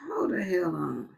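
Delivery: whispered under his breath. Real, not acted. Stunned by menu prices, whispering to himself. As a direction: hiss it to yourself in shock.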